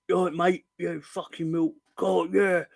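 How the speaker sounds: lightly mocking Cockney lad voice, imitating Millwall fans. Mocking Millwall fans on the terraces, light Cockney voice. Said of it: "Oh, yeah, you're, you're Cockney".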